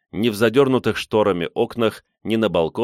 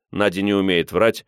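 usual speaking voice

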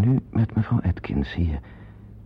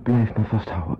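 usual speaking voice

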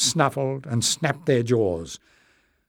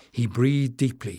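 ordinary delivery

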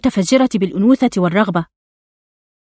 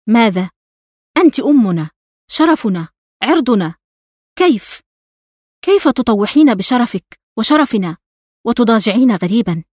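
mildly raised, indignant narrated outcry; accusing cry. A narrator's reading. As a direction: throw out the accusing questions, restrained outrage. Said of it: "صرخت". (صراخ استنكار مخفف)

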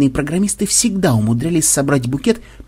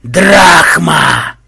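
loud voice from the grave; eerie, threatening crypt-monster shout; menacing sepulchral voice; 3 semitones higher, 9 dB louder than usual. A narrator's reading. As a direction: boom it out like a threatening undead ferryman. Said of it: "Раздался угрожающий замогильный голос".

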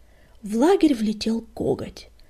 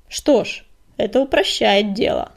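louder, dismissive retort; snorting; 9 dB louder than usual. A narrator's reading. Deputy dismisses the prisoner's fate, scornful. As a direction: brush it off with scorn. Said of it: "фыркнул Коготь".

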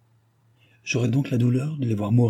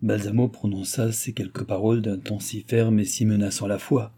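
ordinary delivery, nothing marked

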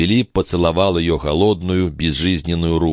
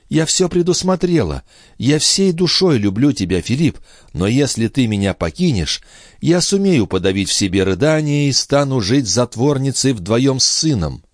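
put-on higher, impassioned young woman's voice; a hint of a resolute, devoted mother, pitched up; lightly voiced young mother; 8 semitones higher than usual. (слегка обозначенный высокий голос молодой матери, с решимостью)

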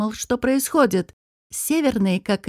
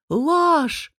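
mild shout; 6 semitones higher than usual. A narrator's reading. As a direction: cry out the denial in a raised pitch. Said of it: "закричал Шамир". (сдержанный выкрик)

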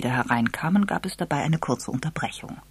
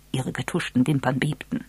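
usual speaking voice

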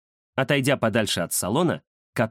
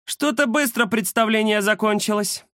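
mock the fleeing fighters; mocking cat lady; 10 semitones higher than usual. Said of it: "хрипло расхохоталась".

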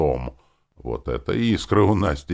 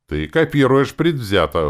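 high, loud indignant protest; 8 semitones higher, 8 dB louder than usual. A narrator's reading. Hero objecting to a biased copy of himself, loud indignation. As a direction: protest it loudly, offended. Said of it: "возмутился я".

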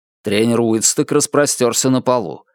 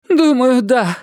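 put-on high-pitched old lady voice; high, put-on elderly character; elderly woman; 12 semitones higher, slower than usual. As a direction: answer as a shaken old woman. Shaken elderly woman answers Mary.

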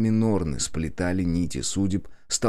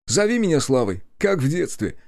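amused cousin; 8 semitones higher than usual. A narrator's reading. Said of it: "хмыкнул он".

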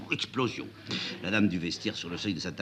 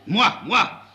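mocking repeat; 12 semitones higher, 8 dB louder than usual. A narrator's reading. Mocking the prince's "moi.

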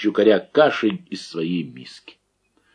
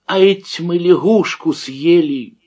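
high, slow horrified realisation; shocked exclamation; 8 semitones higher, 5 dB louder than usual. A narrator's reading. Akim realises they ate a frog, high and aghast. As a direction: let the horror dawn slowly. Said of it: "воскликнул потрясённый".

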